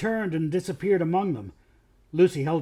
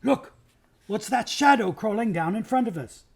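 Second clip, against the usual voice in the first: excited cry; 6 semitones higher than usual. Acted. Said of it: "he cried in great excitement".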